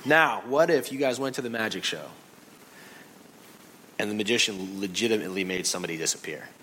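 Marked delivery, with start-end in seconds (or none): none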